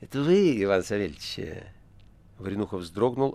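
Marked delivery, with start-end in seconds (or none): 0.0-1.4 cat-like, insinuating question; purring. A narrator's reading. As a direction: purr the greeting. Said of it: "мурлыкнувший". (мурлычущий кошачий голос)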